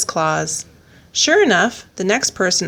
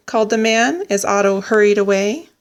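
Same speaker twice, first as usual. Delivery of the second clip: usual speaking voice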